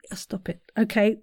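ordinary talk, nothing marked

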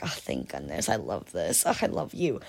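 low, soft relieved-dog voice; dog's inner voice. Acted. Owner voicing her dog reunited with family, low and relieved. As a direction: melt with relief as a clingy dog. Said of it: "all that goes through his head".

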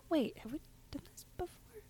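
hushed, fast stage whisper of suspicion; badly acted eerie whisper; mock whisper. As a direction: whisper it like you sense something odd. Acted deja vu skit.

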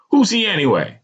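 high-pitched irritated retort; snapping. A narrator's reading. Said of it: "I snapped".

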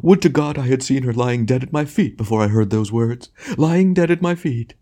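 high, hammed-up lament; anguished tragedian. A narrator's reading. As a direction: declaim with grand despair, drawing out the end.

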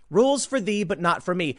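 ordinary talk, nothing marked

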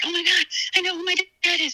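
high, rushed re-enacted freak-out; retold freaking out. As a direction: blurt it fast in a high, overwhelmed voice. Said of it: "just, like, freaking out".

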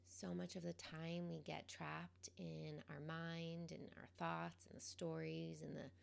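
calm, quiet guidance, leaving space between phrases; guided meditation. Real, not acted. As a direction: gently guide them into the present moment, unhurried.

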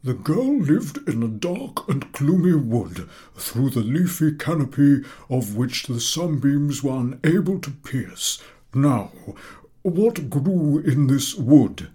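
slow, high, grand summary; lecturing professor. A narrator's reading. Professor summing up for the class, slow and ornate. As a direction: sum up grandly.

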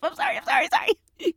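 hammed-up crying apology; mock terrified whimper. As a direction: put on fake tears for the cop. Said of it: "I act so scared and nervous and fumbling".

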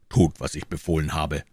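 usual speaking voice

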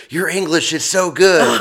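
high, slow amazed praise; impressed German coworker. Retold or imitated. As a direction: mimic a coworker gushing over his English. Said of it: "He's like".

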